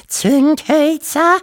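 very high, drawn-out, loud wail; wailing mother. A narrator's reading. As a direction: wail the question as a dismayed mother. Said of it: "jammerte die Wawuschel-Mutter". (laut und hoch jammernde Mutter)

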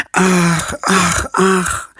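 put-on a touch of a child's sad sighing. Nele sighs after hearing the fox caught Emma, mild sighing. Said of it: "seufzt Nele".

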